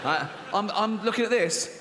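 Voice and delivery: ordinary talk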